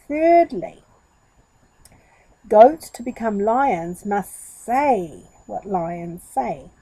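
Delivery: slow and weighty; a showman laying down a law; dramatic. Acted. Monkey hurrying on before goat can speak, slow and grand. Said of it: "a dramatic pose".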